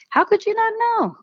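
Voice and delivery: squeaky disbelief; incredulous. Retold or imitated. Scoffing at patients' answer.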